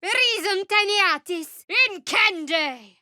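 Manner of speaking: high, loud, slow spell chant; slow shouted incantation. Acted. Casting fire spell, slow shouted incantation.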